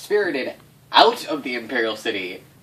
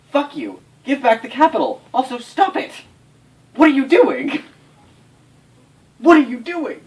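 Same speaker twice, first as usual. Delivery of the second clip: high, loud outraged shouting; angry yelling; 10 dB louder than usual. Acted. GM voicing the Lion clan raging at the Scorpion, shouting. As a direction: yell it at the usurpers.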